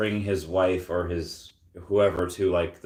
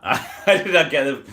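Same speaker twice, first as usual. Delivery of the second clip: laughing; 6 semitones higher, 5 dB louder than usual. Real, not acted. Laughs at the joke.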